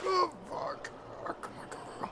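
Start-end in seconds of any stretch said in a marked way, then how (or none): none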